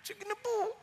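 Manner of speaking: small, high, soft voice; Moses. Retold or imitated. Prophet yields to an angry Lord, meek high soft assent. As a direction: mimic meek giving in. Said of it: "naggalit na siya".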